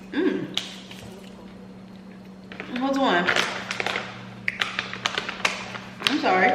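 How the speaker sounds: reacting to sour candy; puckering at a sour bite; eating sour candy. Real, not acted. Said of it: "If it got me doing all that".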